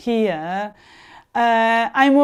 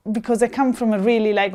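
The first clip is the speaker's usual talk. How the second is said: ordinary talk, nothing marked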